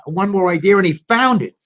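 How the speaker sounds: plain reading voice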